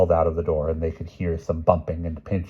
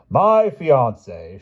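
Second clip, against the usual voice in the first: slow, raised grand announcement; announcing princess; 7 semitones higher, 9 dB louder, much slower than usual. Acted. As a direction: proclaim it slowly. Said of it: "she announced".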